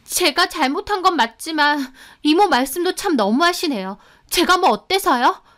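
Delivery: high-pitched aggrieved retort; glaring defiance. A narrator's reading. Housekeeper talks back to mother-in-law, defiant. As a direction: push back, offended and defiant. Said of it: "송혜연도 노려보더라고요".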